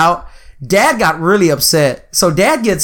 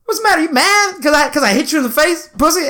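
very high, goading imitation of Dad; mocking drunk provocation, pitched high; talking shit; 11 semitones higher than usual. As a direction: taunt and needle in a raised pitch. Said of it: "so he's talking shit".

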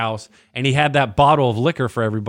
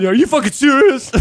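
high, loud incredulous retort; acted-out outraged disbelief; mock outrage; 12 semitones higher, 6 dB louder than usual. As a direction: exclaim it in high-pitched disbelief.

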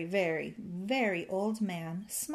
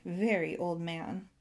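plain reading voice

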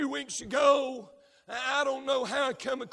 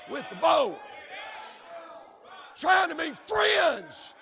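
preaching at full force; 4 semitones higher, 5 dB louder than usual. Real, not acted. Preacher calls out the congregation's friendship with sin, shouting. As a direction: build it and hammer every line.

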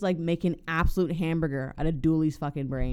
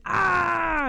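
higher, louder open "Ah!"; mock yell; 13 semitones higher, 5 dB louder than usual. Acting out Robert caught by Dooley at the truck, mock yell. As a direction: cry out loudly as if caught. Dooley catches him, cry acted out.

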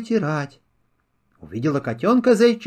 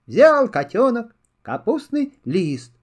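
usual speaking voice

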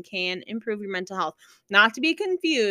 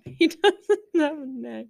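laughing; 7 semitones higher, 4 dB louder, slower than usual. Real, not acted. Laughing at dog picture.

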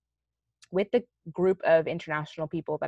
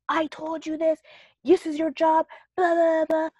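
much higher, louder mock tirade from the mother; retold angry scream; 11 semitones higher, 5 dB louder, slower than usual. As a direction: imitate her yelling at the au pair. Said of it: "screaming at me".

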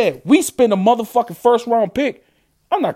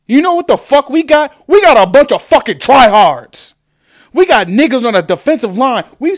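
angry outburst; 5 semitones higher, 5 dB louder than usual. Real, not acted. Furious fan rant.

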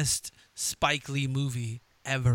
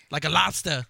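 imitated Boston accent. Teasing the Boston guest with his accent.

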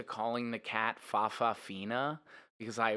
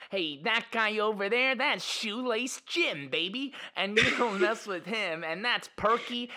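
put-on cool kid narrator; 8 semitones higher, 4 dB louder than usual. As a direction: show off the gang like a tough kid. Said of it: "a group of children where it was like".

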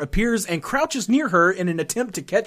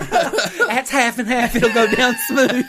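Southern lady voice; 6 semitones higher, slower than usual. Pretend. Continuing Paula Deen bit.

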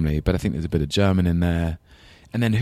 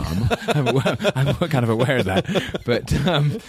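higher-pitched, laughing through the words; self-mocking admission through laughter; 8 semitones higher than usual. Real, not acted. Performer admits he isn't being funny, laughing.

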